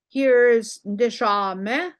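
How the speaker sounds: Ndishame stretched slowly and loud; slow modelled word. Shown as a demonstration. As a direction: draw out Ndishame for the class. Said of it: "teacher models the word".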